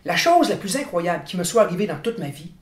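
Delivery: ordinary talk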